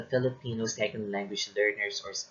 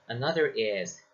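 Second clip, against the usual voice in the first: usual speaking voice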